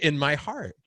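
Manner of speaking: ordinary delivery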